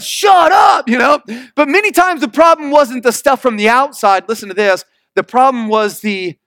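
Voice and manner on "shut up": a sudden high snapped shout of annoyance; mock shout. Preacher mimics snapping at noisy neighbours with a shout.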